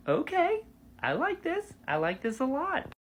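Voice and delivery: high, rising delighted approval; pleasantly surprised. Retold or imitated. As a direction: let the pitch soar as you warm to it. Acting out own delighted reaction.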